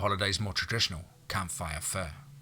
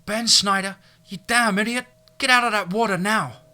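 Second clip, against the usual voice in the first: loud high furious yelling; angry shout; 13 semitones higher, 8 dB louder than usual. Acted. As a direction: scream it at him across the water. Sister yells at brother.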